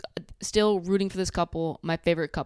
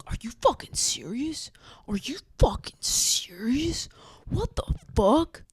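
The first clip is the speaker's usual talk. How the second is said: breathy stunned whisper rising into a high, slow "What the fuck"; mock shocked whisper; 6 semitones higher than usual. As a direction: pretend to be the boyfriend, aghast and hushed, then squeaking in disbelief. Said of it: "he's like".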